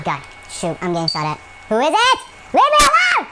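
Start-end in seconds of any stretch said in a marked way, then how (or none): 1.7-3.3 high, loud screaming rage; raging yell. Real, not acted. Gamer raging while being shot, loud yell. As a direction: scream at the attacker. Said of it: "Sorry for the rage there".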